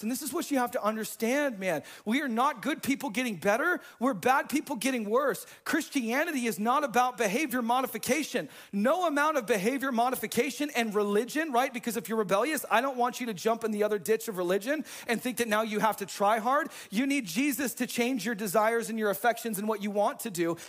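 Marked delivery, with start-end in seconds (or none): none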